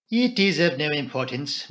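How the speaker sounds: ordinary delivery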